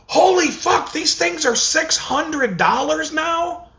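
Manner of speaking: high, slow stunned exclamation; slow, very high mock shock; shocked disbelief. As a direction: gasp it out like you can't believe the price. Shocked at the new price.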